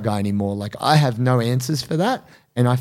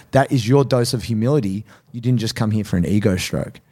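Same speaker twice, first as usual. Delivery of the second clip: usual speaking voice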